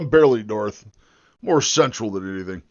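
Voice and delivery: ordinary talk, nothing marked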